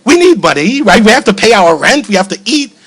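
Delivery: amused panicky pushback; alarmed protest. Retold or imitated. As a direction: hold on, be practical. Protests daughter's plan, laughing.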